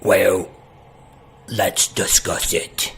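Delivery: low, slow, half-whispered; big guy voice. Acted. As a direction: lean in and keep it secret. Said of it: "this guy says".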